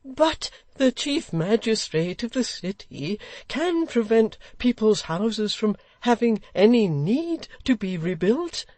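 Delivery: put-on slow, high, heated old gentleman; choleric. Old gentleman arguing with the Lord Mayor, choleric and slow. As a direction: press the point hotly, word by word. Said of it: "cried the old gentleman in a choleric manner".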